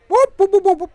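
high, loud wrong-answer siren; buzzer noise. Pretend. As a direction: mimic a game show buzzer.

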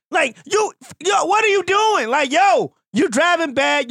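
exasperated driver yelling across lanes. Retold or imitated. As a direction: throw it at the guy texting behind the wheel.

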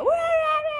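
high-pitched mock group clamour; rame-rame. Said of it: "rame-rame gitu". (menirukan keramaian dengan suara tinggi)

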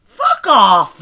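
very loud, punchy outburst; indignant retort. Real, not acted. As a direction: shout it back at a teasing friend. Retort to innuendo teasing.

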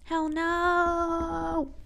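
high, loud, stretched "Hell no"; emphatic refusal. Retold or imitated. Vlogger retelling her own answer, emphatic refusal. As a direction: shut it down firmly. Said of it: "I was like".

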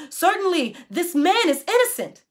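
the centurion praising God aloud. A narrator's reading. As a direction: proclaim it in awe. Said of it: "he praised God".

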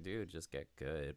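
low, soft, unbothered put-down; quiet. Retold or imitated. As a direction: imitate the calm winner, barely raising the voice. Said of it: "one person can just be quiet as shit".

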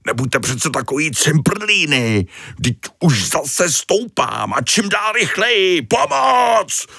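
building from fretful to a high shout; panicked cry for help. Acted. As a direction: call out in rising panic as he floats away. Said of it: "volal Tony z výšky".

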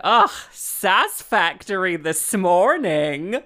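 loud, slow, exasperated sass; eye-rolling retort. Retold or imitated. Musician answers the patronizing vicar, sassy and exasperated. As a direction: throw it back with an audible eye-roll. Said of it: "I rolled my eyes and said".

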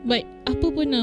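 ordinary talk

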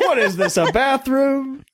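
high and very slow on bathroom; outraged mock complaint, slow bathroom; mock upset catchphrase. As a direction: drag out the complaint in mock disgust. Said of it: "Anytime there's a shitty situation".

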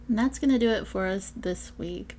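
plain reading voice

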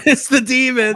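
high giggling line; laughing. Real, not acted. Laughing repeat.

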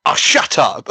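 high, loud retold telling-off; mock scolding shout. Wrestler silencing her sulking colleague, mock exasperated shout. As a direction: snap it out high and loud, playfully. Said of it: "she just goes at the end of it".

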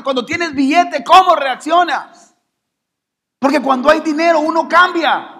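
high, driving sermon questions; full-force preaching. Acted. Preacher challenging congregation about emotions and money, full-force preaching.

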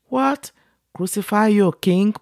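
can't believe what they ask; incredulous. A narrator's reading. Pilate questioning the crowd, high incredulous. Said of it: "Pilate asked".

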